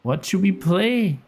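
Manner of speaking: high, theatrical shopkeeper voice; a high, put-on accent for the scheming seller; attempted character accent. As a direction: play the sly map seller, pitched high. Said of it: "That's not his accent".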